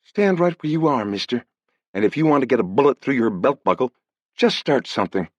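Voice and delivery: put-on a young woman's cool threat; cool girl's voice. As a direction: warn him off, steady and cool. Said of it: "a cool girl's voice said".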